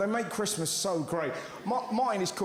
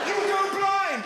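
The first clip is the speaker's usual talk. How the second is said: shocked mum; 11 semitones higher, 5 dB louder, slower than usual. Retold or imitated.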